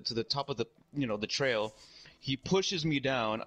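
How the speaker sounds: ordinary delivery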